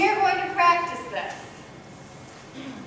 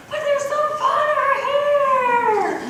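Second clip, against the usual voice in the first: much higher, slow, imitating a pleading child; whiny kid voice; 12 semitones higher, 6 dB louder, slower than usual. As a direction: stretch it out like a little boy begging. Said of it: "And he's like".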